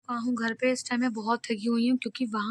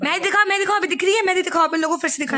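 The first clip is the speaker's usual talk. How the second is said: eager urging; 6 semitones higher, much faster than usual. Real, not acted. Urging aunt to show mehndi.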